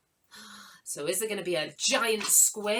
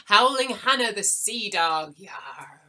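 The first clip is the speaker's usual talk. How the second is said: pirate; 3 semitones higher, 8 dB louder than usual. Pretend. Host calls out a viewer's name, playful pirate.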